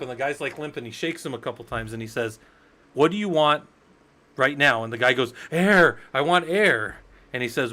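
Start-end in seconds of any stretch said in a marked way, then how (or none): 2.9-4.8 loud, raised retold demand; storyteller acting out a stern challenge; forceful demand. Socrates shakes the half-drowned man, demanding. 5.5-7.0 loud, high mock-desperate cry; acted-out urgent plea, pitched up. Half-drowned man begs for air.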